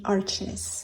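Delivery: "arches" with ch and a final -iz; English-style pronunciation. Shown as a demonstration. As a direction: say "arches" as AR-chiz, two syllables. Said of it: "the English-speaking world would say".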